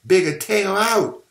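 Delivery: high, loud frantic plea; begging. A narrator's reading. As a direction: cry out, begging him to stop. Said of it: "Vera begged again".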